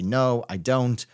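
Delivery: usual speaking voice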